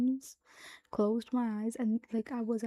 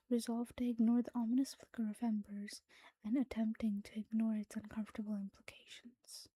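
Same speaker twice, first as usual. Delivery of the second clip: whispered story reading. Acted. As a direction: read the story in a hushed whisper.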